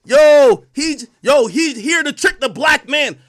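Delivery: loud shouted outburst, slow on the last line; exasperated shout at gangster rappers. Real, not acted.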